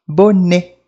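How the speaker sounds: "Bonnet" ending on a vowel; loud, clear French "Bonnet" without T; silent final T. Shown as a demonstration. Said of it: "A gente não fala essas letrinhas".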